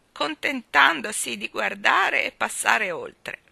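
put-on loud, slow, quavering old balloon seller; trembling old woman. Balloon woman chides the children, in a loud trembling old voice. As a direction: scold them like a frail old woman full of passion.

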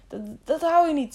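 high-pitched, anxious stammer. A narrator's reading. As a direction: stumble on the first word in alarm. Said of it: "stamelde Jaro". (angstig stamelend)

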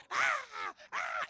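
high, loud imitated crowd yells; preacher mimicking a shouting mob; angry crowd yell. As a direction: throw out the mob's angry "ah". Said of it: "la gente estaba gritando".

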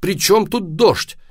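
loud, high, drawn-out exasperation; almost shouting. A narrator's reading. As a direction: stretch out the indignant question. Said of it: "почти закричал папа".

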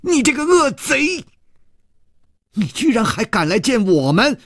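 high accusing cry. Acted. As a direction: call out the villain sharply and high. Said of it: "高声地说".

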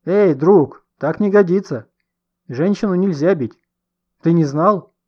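indignant intervention. A narrator's reading. Elderly bystander stopping man hitting woman, loud rebuke. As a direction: call him out firmly.

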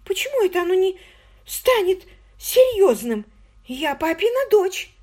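high, indignant and slowed; indignant girl. A narrator's reading. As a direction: protest with wounded pride. Margot indignant.

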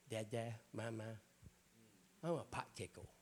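lightly voiced toddler babble; mock baby talk. Preacher mocking a grown child still talking like a toddler, baby talk. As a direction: imitate a small child mangling words.

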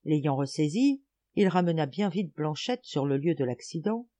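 usual speaking voice

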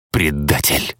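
understated, hissed accusation. A narrator's reading. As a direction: hiss it, held back. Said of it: "прошипел охотник".

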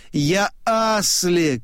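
restrained narrated cry. A narrator's reading. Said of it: "кричит он".

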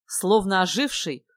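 ordinary delivery, nothing marked

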